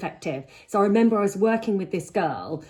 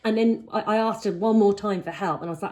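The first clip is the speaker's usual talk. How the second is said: ordinary talk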